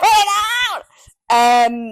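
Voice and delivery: high, loud and drawn-out call; playful shout. Real, not acted. Host shouts the catchphrase at her cohost. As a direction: belt it like a rallying cry.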